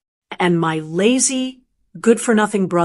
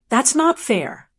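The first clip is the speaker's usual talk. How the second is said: usual speaking voice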